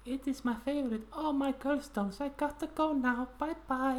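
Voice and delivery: very high squeaky put-on friend voice; playing Marta. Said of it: "Oh, Marta, you're here".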